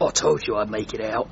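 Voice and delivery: low tough soldier tone; tough soldier growl. Acted. Frogger to chief, low tough-guy voice. Frog sergeant talking tough.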